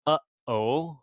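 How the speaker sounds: "uh-oh" with a catch between the vowels; glottal stop. Shown as a demonstration. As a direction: cut the air sharply between "uh" and "oh". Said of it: "this little symbol is representing".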